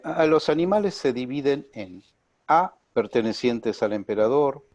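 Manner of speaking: deliberate, spaced-out list reading. Real, not acted. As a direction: read it out slowly, pausing after the letter. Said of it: "hablar un poco más despacio".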